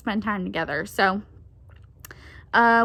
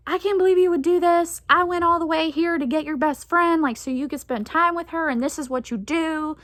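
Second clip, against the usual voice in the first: higher, faster scolding in mom's voice; mock a scolding mother; imitating upset mom; 6 semitones higher than usual. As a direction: daughter imitating her upset mom's lecture. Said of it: "she was rightfully upset".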